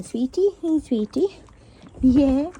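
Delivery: tender pet voice. Real, not acted. Greeting horses at night.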